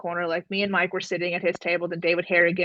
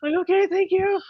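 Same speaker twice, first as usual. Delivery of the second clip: teary thanks; 11 semitones higher than usual. Retold or imitated.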